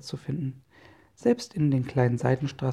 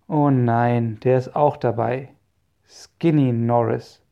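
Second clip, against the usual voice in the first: loud dismay, dragging out the rival's name; mildly acted dread at spotting a rival; dismayed groaning; 7 dB louder, slower than usual. A narrator's reading. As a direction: groan it out, heavy on each word. Peter spots the arch-rival.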